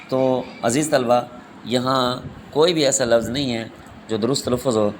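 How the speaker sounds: normal talking voice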